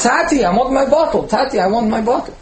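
put-on high, whiny little boy voice; small child. Toddler on the kitchen counter begs his father for the bottle, high and whiny. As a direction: play the crying toddler begging Tati. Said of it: "And he says".

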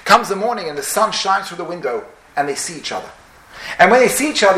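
ordinary talk

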